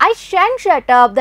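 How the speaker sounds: normal talking voice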